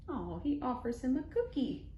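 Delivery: plain reading voice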